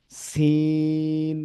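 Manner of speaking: সিন held very long and level; long chanted সিন; three-alif prolongation. Shown as a demonstration. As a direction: stretch সিন for three counts, as in recitation. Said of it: "যে হরফগুলো তিন আলিফ টেনে পড়তে হয়".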